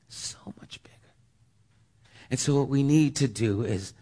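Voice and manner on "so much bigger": so much bigger in a bare unvoiced whisper; whispered echo. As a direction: breathe the repeat, all air and no voice. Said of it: "God is so much bigger".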